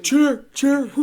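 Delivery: high, loud mock cheering; cheering crowd. Everybody at the cage fight, mock cheer. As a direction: call it out like a whole crowd. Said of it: "Everybody's like".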